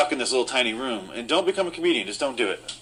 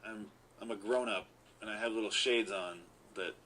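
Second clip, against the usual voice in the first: ordinary talk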